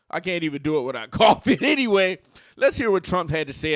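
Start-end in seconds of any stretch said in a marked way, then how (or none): none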